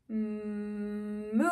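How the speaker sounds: low, soft, long moo; cow moo. Acted. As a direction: hum the M, then draw out MOO. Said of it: "A cow says".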